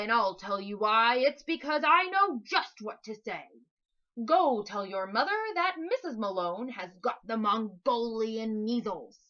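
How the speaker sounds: very loud, low monster voice with big punched; booming Big Fat Lie. Acted. Monster introducing itself to Max, booming. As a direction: boast the name like a giant.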